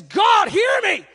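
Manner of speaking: high, loud cry to heaven; screamed plea. Acted. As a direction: scream it up at the sky. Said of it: "I'm screaming at the heavens".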